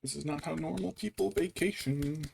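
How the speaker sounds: very high, soft and slow drawn-out swear; weary exasperation. Retold or imitated. Complaining about the in-laws' vacation, high and drawn out. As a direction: sigh it out, fed up. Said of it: "It's just like".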